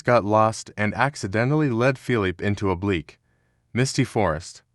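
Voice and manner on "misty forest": ordinary delivery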